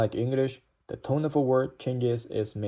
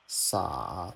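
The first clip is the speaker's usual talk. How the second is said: "sa" dipping low and soft, then rising; third tone; 5 semitones lower, 6 dB quieter than usual. Shown as a demonstration. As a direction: scoop "sa" deep down and up. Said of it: "the logic is all the same".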